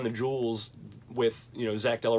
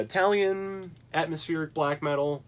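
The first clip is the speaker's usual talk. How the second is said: normal talking voice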